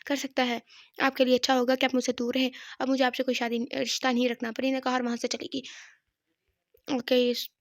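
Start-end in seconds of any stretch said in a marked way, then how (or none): none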